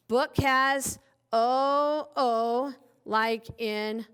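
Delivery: slow, spaced letter sounds; slow classroom sounding out; slow teacher sounding. Shown as a demonstration. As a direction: draw out O O slowly. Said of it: "teacher slowly sounding letters".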